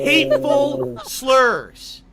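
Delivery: slow, loud disbelief; explosive rage. Real, not acted. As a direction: spit the words out in rage. Repeats accusation in outrage.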